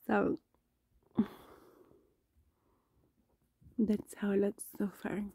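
speaking through numb lips. Real, not acted. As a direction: whisper with a numbed mouth. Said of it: "my lips are numbed here".

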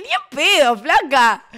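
loud, high flat no; turning the girl down, loud and high; firm refusal. Retold or imitated. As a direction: shut it down hard.

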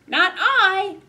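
high, loud piggy squeal. Acted. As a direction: squeal it like a little pig. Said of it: "squealed the pig".

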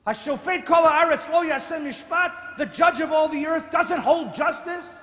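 loud, high thundering challenge; full-force preaching. Acted. Preacher voicing Abraham's challenge to God, thundering. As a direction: drive it home like a prophet.

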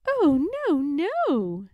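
high, loud "oh no, no"; reassuring grandmother. A narrator's reading.

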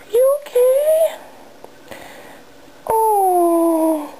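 high, slow, pitying coo; high pet voice. Real, not acted. Fussing over the hurt cat, high pitying baby talk. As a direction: fuss over the poor cat.

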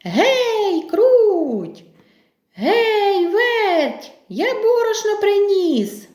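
high, drawn-out rooster's call. Acted. Rooster back with flour calls mice, calling out. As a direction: summon the mice with a high holler. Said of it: "Знов кличе мишенят".

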